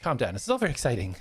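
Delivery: high, eager, reining herself in; high self-calming fluster. Acted. Jonas apologizing to everybody while trying to calm down. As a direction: try to calm down but bubble over.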